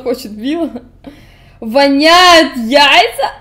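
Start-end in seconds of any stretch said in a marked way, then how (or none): 1.6-3.3 tertawa sambil bertanya; laughing. Real, not acted. Laughing at smelly question.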